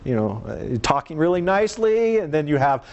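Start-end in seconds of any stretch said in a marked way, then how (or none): 0.8-2.2 higher, nicey-nice voice; sweetly polite high pitch. Shown as a demonstration. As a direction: lift it up high, talking nicely. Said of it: "sometimes you have the high-pitched voice".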